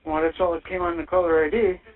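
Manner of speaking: ordinary delivery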